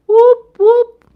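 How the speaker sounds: high, loud whoop whoop; whooping cheer. Real, not acted. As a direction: cheer like a fan in the stands. Cheering for the Cowboys.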